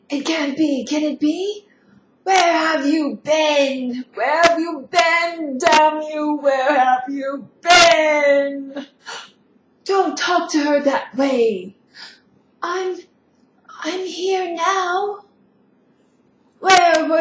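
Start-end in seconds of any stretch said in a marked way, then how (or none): 2.2-8.9 furious, tearful shout, slow and loud; angry crying shout. Acted. Molly confronts Schmendrick angrily. 9.8-15.2 shouted rebuke. Acted. Defending the unicorn in quarrel.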